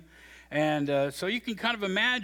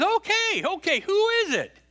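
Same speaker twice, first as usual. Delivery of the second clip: put-on startled boy; 6 dB louder than usual. Boy wakes to call.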